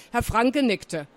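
ordinary delivery

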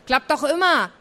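breezy and pleased; cheerful call. A narrator's reading. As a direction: call it out gleefully. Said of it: "rief sie vergnügt".

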